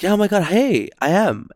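high, gushing retold hello; excited greeting. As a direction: greet a fan with a high lift.

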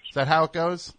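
ordinary delivery

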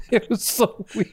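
high, drawn-out words through laughter; laughing. Real, not acted. Recalling the odd exchange to friends, real helpless laughter. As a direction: squeeze it out while cracking up. Cracking up at his own story.

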